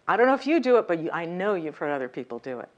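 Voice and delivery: ordinary delivery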